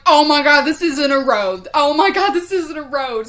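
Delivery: loud, high, panicked reenactment; hammed-up frantic outcry; retold panic. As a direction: reenact realizing a driving mistake in alarm. Retold panic after driving into yard.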